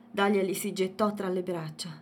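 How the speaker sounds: plain reading voice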